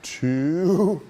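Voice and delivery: loud, drawn-out referee count; referee's count. Retold or imitated. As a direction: imitate a ref calling the count. Referee counting aloud in ring.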